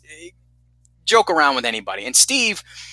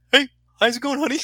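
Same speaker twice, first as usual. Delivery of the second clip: sweet phone voice; 12 semitones higher, faster than usual. Retold or imitated. Steve calls girlfriend.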